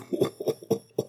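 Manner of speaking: acted mocking laugh; breathy guffaw at someone's expense; burst of laughter. A narrator's reading. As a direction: laugh out in mockery. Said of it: "en éclatant de rire".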